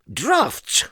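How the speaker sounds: very high squeaky Mister Nonsense; high silly voice. Acted. Narrator voicing Mister Nonsense, high and squeaky. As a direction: pipe it up like a daft little man.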